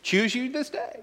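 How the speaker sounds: high, soft, solemn proclamation; soft, high rendering of Joshua's challenge; Joshua's charge. Acted. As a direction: lift it up like an old prophet's call. Joshua's words quoted.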